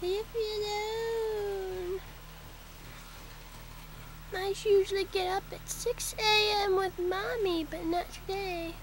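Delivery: high grumpy kitten voice for the cat; voicing the cat. Owner voicing her sleepy cat who wants to be left alone, high grumpy whine. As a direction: put words in the sleepy cat's mouth, whiny and high. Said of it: "She's like".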